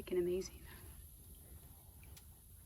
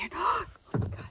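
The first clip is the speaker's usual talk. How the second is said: excited gasp; 11 semitones higher, 7 dB louder than usual. Real, not acted. Spots a rare bird.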